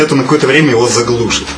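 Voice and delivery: ordinary delivery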